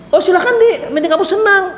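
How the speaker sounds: high, sugary mock permission from the husband; mock indulgent husband. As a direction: play the spineless husband who allows everything. Husband lets wife go anywhere. (suami mengiyakan istri dengan nada tinggi dan manis yang dibuat-buat)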